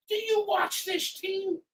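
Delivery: slowed, drawn-out incredulous repeat; slow sarcastic jab. Real, not acted. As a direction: spell it out slowly in disbelief. Fan heckling the coach.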